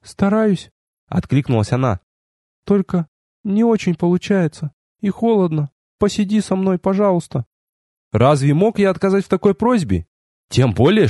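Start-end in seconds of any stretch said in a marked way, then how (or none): 0.0-0.7 put-on girl's voice. A narrator's reading. Narrator voices the girl replying. 2.6-7.5 higher, narrator's lightly acted girl; timid girl's voice. A narrator's reading. Narrator voices cold girl asking for company.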